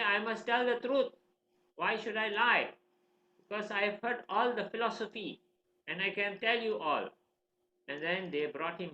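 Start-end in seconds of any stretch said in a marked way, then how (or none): 0.0-7.1 put-on high, insistent boy's retort; earnest protest. Boy defending the truth to his teachers, insistent. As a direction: protest with lifted pitch.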